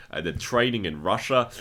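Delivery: normal talking voice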